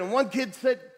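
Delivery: usual speaking voice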